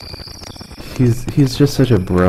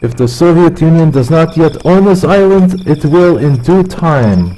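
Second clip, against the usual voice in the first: higher mock Russian accent, slowing at the end; 7 semitones higher than usual. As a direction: proclaim it like a Soviet official, half-joking. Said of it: "I become Russian when I drink".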